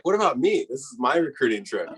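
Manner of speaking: high-pitched mock protest, dropping toward the end; retold indignant protest. Retelling his protest to dad.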